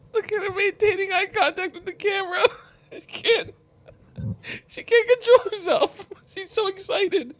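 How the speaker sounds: high-pitched words squeezed out between laughs; can barely speak for laughing; laughing to tears. Real, not acted. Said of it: "I'm crying".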